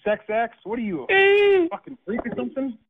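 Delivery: angry man shocked by the caller's talk, outburst; disgusted outburst. Real, not acted. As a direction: throw it out in disbelief.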